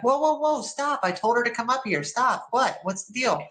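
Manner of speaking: a startled call to stop; captain stepping in. Retold or imitated. Captain stops the guards.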